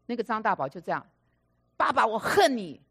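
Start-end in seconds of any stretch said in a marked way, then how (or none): 1.8-2.8 loud, slow, pained yell at father; yelled "I hate you". Retold or imitated. Grown son yelling at his father in therapy, loud slow yell. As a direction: shout it out at the father. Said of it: "就这样大叫".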